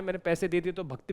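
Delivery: ordinary talk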